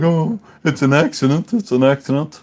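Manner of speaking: mock the son pleading it was an accident; mimicking the son. A dad mimicking his six-year-old son's excuse. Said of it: "he was all like".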